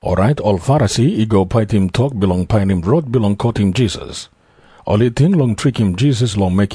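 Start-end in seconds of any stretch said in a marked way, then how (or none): none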